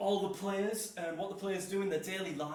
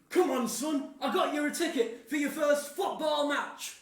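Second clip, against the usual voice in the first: loud, high, hearty announcement; excited granddad; 7 semitones higher, 6 dB louder than usual. Acted. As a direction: call out the big surprise to your grandson. Granddad announcing a surprise ticket.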